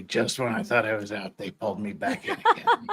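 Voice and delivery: a lightly voiced mob boss, low then rising into a laugh; Godfather quote. Ham up the Godfather line for the stream's dramatic reading, mild mock mob boss. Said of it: "it is Godfather Three".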